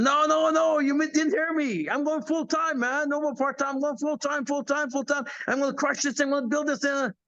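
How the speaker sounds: high, eager protest of his younger self; insisting he's going full time; excited insistence. Retold or imitated. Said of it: "I said".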